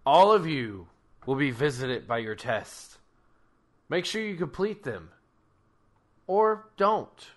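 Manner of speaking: booming Krampus voice; loud deity proclamation. Acted. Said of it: "Krampus is like".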